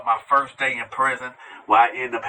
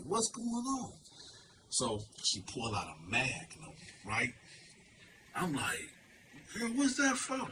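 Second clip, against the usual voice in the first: much softer, hushed retelling; a much softer, secretive murmur; 2 semitones lower, 16 dB quieter than usual. Retold or imitated. She whispered in my ear.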